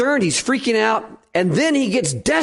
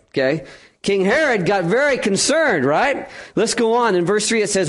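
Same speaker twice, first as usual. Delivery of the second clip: normal talking voice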